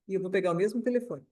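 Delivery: usual speaking voice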